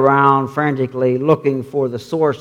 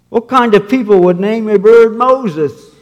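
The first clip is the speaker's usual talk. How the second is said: scoffing burglar; 6 semitones higher, 5 dB louder than usual. Acted.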